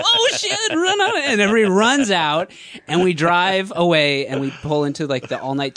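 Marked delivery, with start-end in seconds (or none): 0.0-1.2 high, loud panicked cry; panicked yelp. Retold or imitated. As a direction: bolt in mock panic. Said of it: "then we all go".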